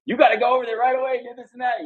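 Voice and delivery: high-pitched, fast nagging urgency; urgent urging. Retold or imitated. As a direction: mimic an excited wife giving orders. Wife urges husband once home.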